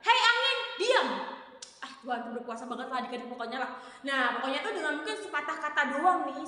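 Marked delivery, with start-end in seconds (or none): 0.1-1.1 high, firm command to the wind; commanding rebuke. Acted. The Lord commanding the wind, firm and high. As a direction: order the storm to be still. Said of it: "Mungkin Tuhan bilangnya gini".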